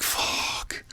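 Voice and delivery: soft, breathy swear of retold dread; whispered curse. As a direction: whisper it to yourself, voiceless. Acts out his dread afterwards.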